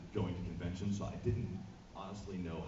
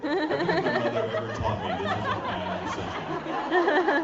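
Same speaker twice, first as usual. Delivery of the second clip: high, slow, loud laughing panic; laughing alarm; 9 dB louder than usual. Real, not acted. Recalling his mother's warnings, a high slow laughing panic. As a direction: laugh it out in mock horror. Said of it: "Like, this is bad".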